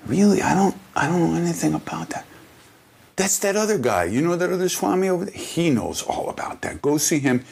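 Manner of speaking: high, innocent playing-dumb guru; high sing-song guru feigning innocence; playful guru voice. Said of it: "He said".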